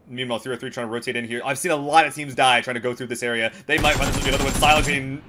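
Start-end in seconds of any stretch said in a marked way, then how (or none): 1.4-5.2 building excited esports commentary; live play-by-play. Acted. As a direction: call the fight, rising intensity. Caster calls live fight.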